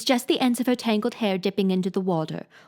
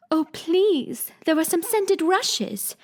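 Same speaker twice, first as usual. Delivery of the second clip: higher, delighted cry, slowing on the rushes; a child's higher delighted cry; sudden delight; 7 semitones higher, much slower than usual. Acted. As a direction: gasp it out in sudden joy, higher. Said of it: "cried in a sudden transport of delight".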